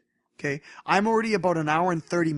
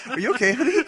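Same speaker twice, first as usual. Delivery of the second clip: laughing; 6 semitones higher, 5 dB louder, faster than usual. Retold or imitated. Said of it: "and I'm like".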